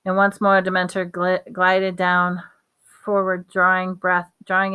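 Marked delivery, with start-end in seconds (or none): none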